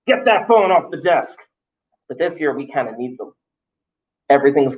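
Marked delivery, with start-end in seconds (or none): 0.0-1.4 high-pitched mock yell at students; retold yell. Teacher recalling yelling at students about phones, mock yell. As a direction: imitate a mild scolding call, pitched up then falling. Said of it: "I would yell at students saying".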